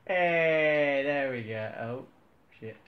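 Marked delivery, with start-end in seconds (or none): 0.1-1.0 loud happy cry; excited shout. Real, not acted. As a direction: shout out in delight. Truck finally gets moving.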